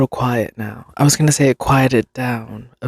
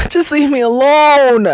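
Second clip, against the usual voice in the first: hammed-up cry of frustration; exasperated outburst; 12 semitones higher, 5 dB louder than usual. Pestered callee venting at robocallers, exasperated wail. Venting about robotic phone calls.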